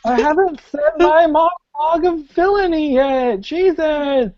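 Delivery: high-pitched whine of a thwarted villain; pleading villain. Acted. Game master as villain interrupted mid-monologue, high pleading. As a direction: protest in a high, put-on villain voice. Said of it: "I imagine he's trying to go through this soliloquy".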